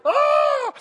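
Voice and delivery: sudden high, loud cry of pain; pain scream. Retold or imitated. Child burning his hand on the stove, screaming in pain. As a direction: scream as if your hand just hit the flame. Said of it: "the second he's like".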